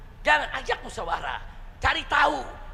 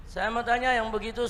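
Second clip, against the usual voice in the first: normal talking voice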